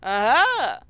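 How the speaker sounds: gloating pirate aha; triumphant aha. Acted. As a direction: crow it out in triumph. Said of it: "makes me laugh and triumph".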